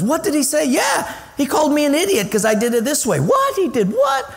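high-pitched scandalized gossip; mock outrage. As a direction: act out shocked, excited back-and-forth gossip. Acting out shocked gossip over offense.

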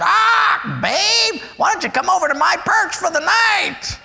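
a rude parrot hitting on her; high, shouted lecherous parrot; lewd parrot. Retold or imitated. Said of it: "turns over and said".

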